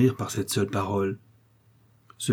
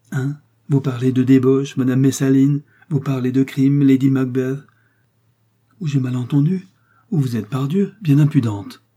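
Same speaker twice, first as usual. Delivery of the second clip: loud, higher outrage; outraged; 4 semitones higher, 7 dB louder than usual. A narrator's reading. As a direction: hurl the insults at her. Baron outraged by her accusation.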